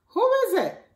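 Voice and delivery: high called question at the door; character voice. Acted. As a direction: ask who's at the door in a bright character voice.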